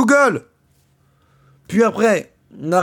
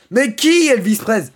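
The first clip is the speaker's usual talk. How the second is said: higher, louder indignant question; exasperated question; 5 semitones higher, 4 dB louder than usual. Real, not acted. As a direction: demand an answer, fed up.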